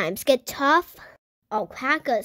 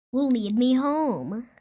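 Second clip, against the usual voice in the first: ordinary delivery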